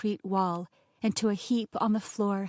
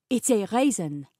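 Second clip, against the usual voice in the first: put-on louder French-accented chef; thick French accent; 5 dB louder than usual. A narrator's reading. Chef identifying the globby bit of oatmeal, thick French accent. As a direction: answer firmly as a French chef. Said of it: "in a thick French accent".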